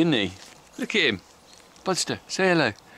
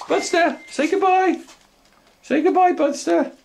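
much higher, excited sing-song to the dog; dog-talk voice. Real, not acted. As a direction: coax the dog to get ready for the throw. Getting the dog to say goodbye.

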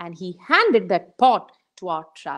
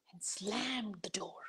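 hushed storyteller aside; whispered narration; 20 dB quieter than usual. A narrator's reading. Storyteller whispering as the boy slams the door, hushed. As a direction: breathe it out in a whisper. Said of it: "narration dropped to whisper".

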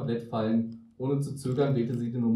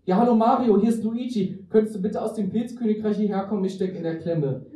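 high, raised telephone voice; phone call; 7 semitones higher, 5 dB louder than usual. A narrator's reading. Luigi phones his brother Mario from custody, phone call. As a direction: call for help in a lifted, loud voice.

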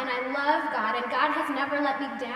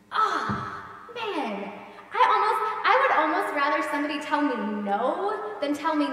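usual speaking voice

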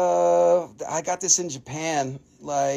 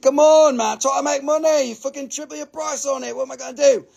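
exasperated rant; 8 semitones higher than usual. Real, not acted. Seller ranting about Donghai price hikes, exasperated. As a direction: vent at the sellers. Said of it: "it makes me so pissed".

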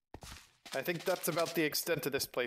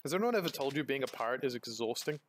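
usual speaking voice

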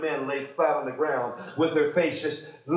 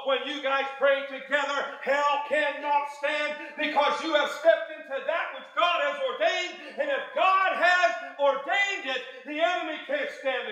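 high, raised preacher's shout; preaching at full force; 9 semitones higher than usual. Real, not acted. Preacher declaring unity's power, shouting. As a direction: drive it home to the crowd.

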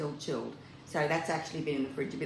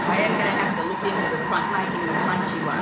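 louder, talking over the noise of the blender; raised voice to be heard over the kitchen appliance; 3 semitones higher, 10 dB louder than usual. Real, not acted. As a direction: speak up over the machine.

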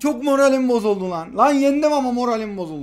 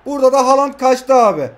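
excited shout; 4 semitones higher, 8 dB louder than usual. Real, not acted. Gamer reacting to missed chance.

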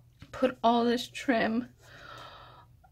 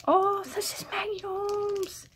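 thrilled recognition; 10 semitones higher than usual. Real, not acted. Recognising what sissy shared with her, thrilled. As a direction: exclaim it way up high, delighted.

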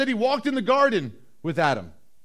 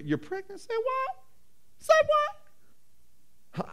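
very high, squeaky disbelief; hammed-up incredulous squeal; shocked mock falsetto; 6 dB quieter, much slower than usual. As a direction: shoot way up high in comic shock. Acts Joseph hearing of the pregnancy.

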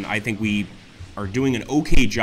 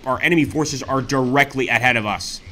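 usual speaking voice

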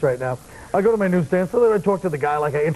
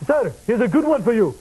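repeated East Indian impression, pitched higher; East Indian accent; 4 semitones higher than usual. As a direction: put on a seller's East Indian accent on request. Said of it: "that was my East Indian accent".

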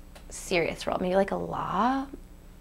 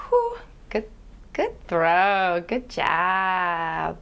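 sudden cheer; 6 dB louder, much slower than usual. Pretend. Sudden sporty cheer mid-meditation.

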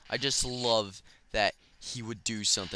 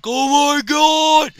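high, loud, slow victory shout; imitated TG Man cheering, hammed-up; triumphant cheer; 11 dB louder, much slower than usual. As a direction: yell like you finally beat it. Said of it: "he was like".

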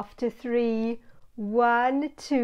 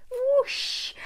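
whooshing wind; 12 semitones higher than usual. Acted. Gust of wind sound.

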